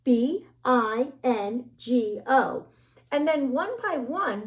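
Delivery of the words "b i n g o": each letter slow and clear; slow spelled letters. Shown as a demonstration. Teacher spelling slowly to the children. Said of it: "we're gonna practice saying".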